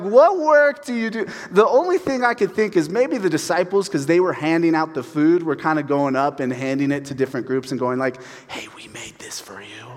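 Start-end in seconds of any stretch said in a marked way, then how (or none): 0.0-1.3 much higher, louder, disbelieving question; mock them for asking after the miracle; squeaky, hammed-up outrage; incredulous mockery. Mocking the crowd's question. 8.5-9.9 conspiratorial whisper; a sneaky aside, barely voiced. Disciples secretly handing out food.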